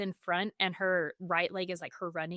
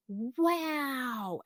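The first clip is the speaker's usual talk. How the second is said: put-on high, hushed wonder like a child; a little girl seeing clearly for the first time; mother imitating her daughter's awed wow; 6 semitones higher than usual. Daughter amazed by new glasses.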